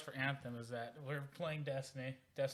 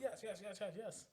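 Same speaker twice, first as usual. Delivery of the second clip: high-pitched giddy yeses with a laugh; 8 semitones higher, 5 dB quieter than usual. Retold or imitated. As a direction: imitate a friend too thrilled to contain himself. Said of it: "being all giddy".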